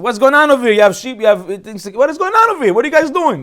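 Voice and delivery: high, loud indignant boss; mock scolding. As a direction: tell off the lazy workers, loud and high. Yaakov scolding lazy workers.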